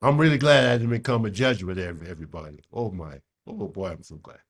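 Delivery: ordinary talk, nothing marked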